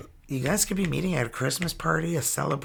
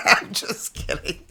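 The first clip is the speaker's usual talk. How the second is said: laughing; 4 semitones higher than usual. Real, not acted. Laughs off joke.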